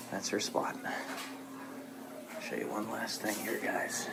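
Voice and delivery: hushed whisper near the cat. Real, not acted. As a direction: drop to a soft whisper. Quietly walking past the cat.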